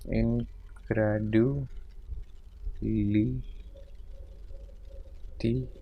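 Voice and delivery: indefatigability softly stretched out syllable by syllable; murmured spelling out. Shown as a demonstration. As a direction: slowly murmur indefatigability while writing. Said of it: "murmured slow while writing".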